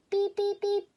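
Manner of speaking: very high, loud beep beep beep; squeaky beeps. Acted. Baby crow failing to sing scales, squeaking. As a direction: pipe out three tiny beeps.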